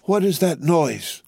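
slow, high-pitched questioning; drawn-out, startled query; alarmed question. A narrator's reading. Doctor hears uproar outside.

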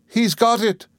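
very high cry of horror; alarmed cry. A narrator's reading. Miss Murdstone seeing boy holding baby, crying out in alarm. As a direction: cry out in panic, pitch leaping up. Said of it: "cried Miss Murdstone".

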